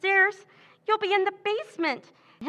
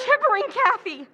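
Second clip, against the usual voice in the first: higher, fast, impatient cut-off; irritated snap; 6 semitones higher, 3 dB louder, faster than usual. Acted. Pam impatiently silences panicking Kathy, snapping. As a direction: shut her up sharply.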